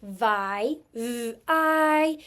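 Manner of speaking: VI, then a buzzed V and a long I; sounding out. Shown as a demonstration. Teacher spelling an open syllable, sound by sound. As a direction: split VI into its two separate sounds. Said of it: "I hear that in its own syllable".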